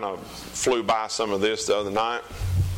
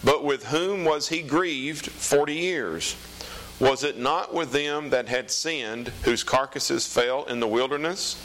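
raised, slow solemn Bible reading; scripture reading; 5 semitones higher than usual. Acted. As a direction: read it out like the pulpit. Reads scripture aloud.

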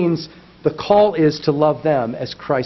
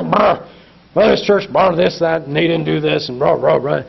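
grumbling critic; 5 dB louder than usual. Retold or imitated. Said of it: "just go".

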